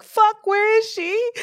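very high, drawn-out frantic cry; retold panic. Cat owner on phone to chip service, hammed-up high panic. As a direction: act out a desperate high-pitched demand.

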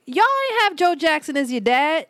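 ordinary delivery

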